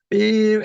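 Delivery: high, drawn-out horn imitation; sustained horn beep. Driver keeping the horn on for the examiner, long imitated beep. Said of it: "Keep it on".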